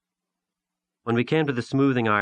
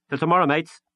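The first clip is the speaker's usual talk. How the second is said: put-on boyish farewell; 8 semitones higher, 5 dB louder than usual. Boy's cheerful farewell.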